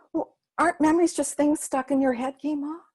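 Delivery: put-on a little girl puzzling it out; curious child.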